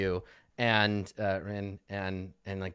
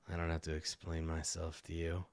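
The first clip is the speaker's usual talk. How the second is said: surly dog owner; 7 semitones lower, 13 dB quieter than usual. Acted. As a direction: send them off quietly in a low voice. Game master voices surly man.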